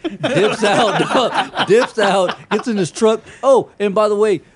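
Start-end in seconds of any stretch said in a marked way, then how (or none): none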